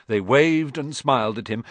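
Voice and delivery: plain reading voice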